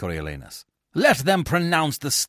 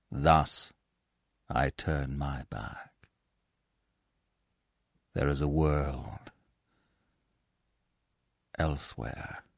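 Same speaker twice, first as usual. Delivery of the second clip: quiet parting; 12 semitones lower, 6 dB quieter than usual. Acted. Coriolanus leaving Rome, hushed farewell. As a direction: let the last words fall away.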